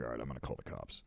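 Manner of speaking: low, soft, fast aside; resigned mutter. Retold or imitated. Deciding to call the cops, low and muttered. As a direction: say it to yourself, done with it. Resigned decision after the abuse.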